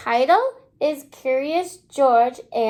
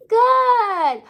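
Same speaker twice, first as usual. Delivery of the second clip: high, loud cheerful "good"; excited praise; 7 semitones higher, 3 dB louder than usual. Real, not acted. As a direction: reward the little ones with big praise. Praising children's answer.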